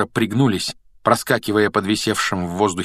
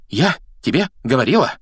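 winded, higher-pitched reproach; breathed out; 5 semitones higher, 4 dB louder than usual. A narrator's reading. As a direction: gasp it out word by word, a little louder. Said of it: "выдохнула Гермиона". (на выдохе, с упрёком)

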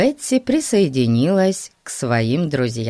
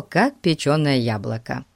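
plain reading voice

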